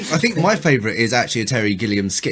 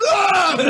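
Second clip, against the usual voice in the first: high sudden mock shriek; mass leaf scream. All the leaves fall at once, high mock scream. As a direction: burst out in a high comic scream. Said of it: "all the leaves just go".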